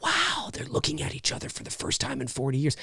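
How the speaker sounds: soft, low, wonder-struck murmur; awed hush. Retold or imitated. Writer voicing the audience's hushed wonder at a reunion. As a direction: breathe it like a moved audience member. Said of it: "can feel".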